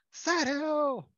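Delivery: high, loud call across the store; calling out a name. Retold or imitated. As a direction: shout his name to catch his attention. Man calls name in supermarket. (llamando a alguien en voz alta y aguda)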